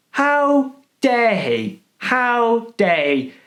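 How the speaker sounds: milk the fake offence slowly; theatrical indignation; mock outrage.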